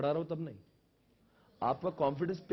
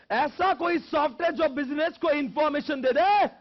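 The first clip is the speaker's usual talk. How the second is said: high, loud lecturing shout; raised, ringing teacher's delivery; emphatic dictation; 10 semitones higher, 10 dB louder than usual. Shown as a demonstration. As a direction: call the definition out to the whole class. Calling out definition for class.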